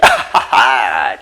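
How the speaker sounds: low open chuckle; real laugh. Real, not acted. Teacher amused before saying he is just kidding, real laugh. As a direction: laugh it off in a short run of ha-has. Laughs at his own joke.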